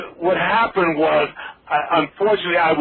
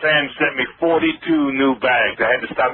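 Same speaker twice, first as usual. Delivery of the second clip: ordinary delivery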